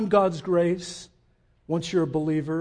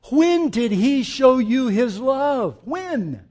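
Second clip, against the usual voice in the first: slow insistent question; 6 semitones higher, 7 dB louder, slower than usual. Acted. Preacher repeats question.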